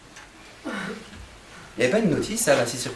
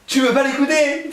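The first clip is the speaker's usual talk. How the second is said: much higher and louder, pressing the crowd; preaching at full force; 11 semitones higher, 4 dB louder than usual. Acted. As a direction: cry out the challenge to the crowd. Preacher's rising exhortation. (prêche enflammé, beaucoup plus aigu et fort)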